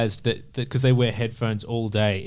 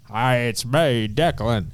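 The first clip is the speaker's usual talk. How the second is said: Declan impression; slower than usual. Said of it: "And we got Declan".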